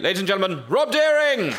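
rousing live-show introduction; announcer's introduction. Real, not acted. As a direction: build to a high, loud shout on the name. Host introducing act to audience.

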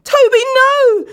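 slow, very high and loud scream. A narrator's reading. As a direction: scream in terror. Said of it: "Dan screamed out".

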